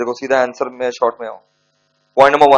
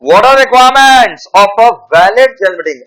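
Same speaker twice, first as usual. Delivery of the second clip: loud, drawn-out dictation of a question; teacher dictating the question to the class; slow dictation; 6 semitones higher, 8 dB louder, much slower than usual. Acted. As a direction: read the question slowly so all can copy it.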